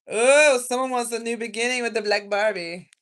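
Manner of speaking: loud, high burst of discovery; real excited "oh" reaction; excited outburst. Real, not acted. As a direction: exclaim it loudly, starting high. Sudden reaction to a card.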